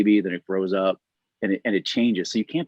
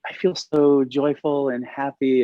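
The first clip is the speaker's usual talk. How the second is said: joyful, slow; 5 semitones higher, slower than usual. Retold or imitated. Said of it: "I said".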